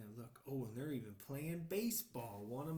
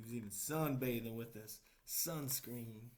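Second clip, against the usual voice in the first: ordinary delivery, nothing marked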